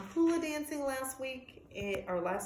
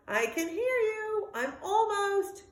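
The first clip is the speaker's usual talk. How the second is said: eager calling; 10 semitones higher, slower than usual. Acted. Dog calling to bird.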